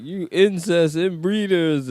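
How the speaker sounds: high, drawn-out mock yell without much volume; imitated yelling. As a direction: mimic a heckler's slow, high-pitched jeer. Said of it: "they were yelling like".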